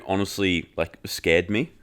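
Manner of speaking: ordinary talk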